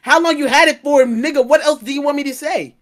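loud, high-pitched exasperation; exasperated protest. Real, not acted. Friend protesting coach's nitpicking, exasperated and loud. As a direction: argue back at full volume, fed up.

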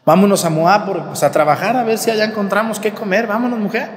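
lightly acted eager husband; husband urging his wife. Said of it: "entonces Elimelec le dice a Noemí".

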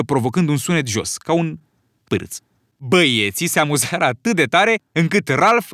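plain reading voice

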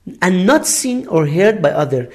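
very slow, careful English read for learners; slow classroom dictation voice; slow example reading. Shown as a demonstration. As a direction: spell out each word slowly like a teacher. Teacher reads dictionary definition.

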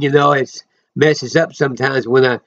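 ordinary delivery

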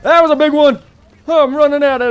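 loud, high whooping reaction; excited outburst. Real, not acted. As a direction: burst out thrilled at the blast. Reacting to a big explosion.

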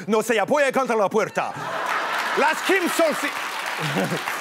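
put-on dramatic Spanish-accented telenovela delivery, drawn-out ending. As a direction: play a Spanish-speaking soap star, hammed up.

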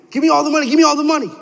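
high, loud, urgent holdup demand; robber's demand. Acted. Robber to store manager at gunpoint, high urgent demand. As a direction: act out an armed robber ordering a victim.

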